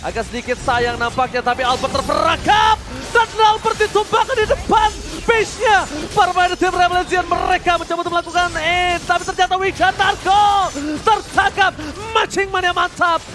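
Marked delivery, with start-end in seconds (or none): 1.6-13.2 high, loud excited call; esports caster hype. Real, not acted. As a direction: yell it as the kill lands. Caster calling a kill. (komentator esports berteriak heboh)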